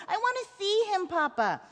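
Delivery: put-on high-pitched child's plea; pleading boy. A narrator's reading. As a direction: beg like a distressed little boy.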